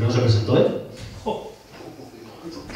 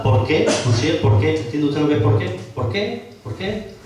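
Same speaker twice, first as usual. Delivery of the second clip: "por qué" spelled out as a question, rising sharply on "qué"; pointed question intonation; 3 semitones higher, slower than usual. Shown as a demonstration. Councillor showing the mayor it's a question, pointed rising "por qué". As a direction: stretch "¿Por qué?" pointedly, lifting "qué" higher with each repeat. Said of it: "Es una pregunta".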